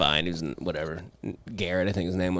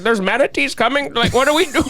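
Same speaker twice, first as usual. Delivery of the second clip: mock panicked mom; 12 semitones higher than usual. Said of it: "hear her in her kitchen being like".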